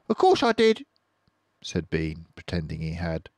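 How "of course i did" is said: high, louder fake confidence; high farmer voice. Bean bluffing to Boggis, high and loud. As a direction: bluff it loudly.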